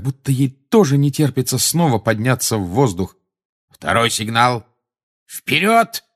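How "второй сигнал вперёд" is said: barked command. A narrator's reading. Lupin launches the escort at the signal, barking. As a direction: bark it, pitch jumping on the last word. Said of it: "рявкнул Люпин".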